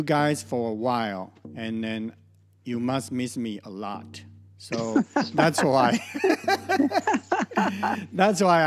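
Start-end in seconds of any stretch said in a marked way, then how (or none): 4.6-6.0 amused, laughing through the words; tickled by your own joke, chuckling. Real, not acted. Joking that listeners missed her. 6.1-8.1 high, giggly burst of laughter; long amused giggle; real laugh. Real, not acted. Laughs at her own joke.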